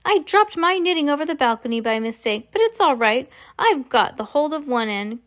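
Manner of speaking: put-on high-pitched, unbothered old grandmother; casual and innocent, pitched well up; cool, unruffled grandmother. A narrator's reading. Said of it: "the coolness of her voice".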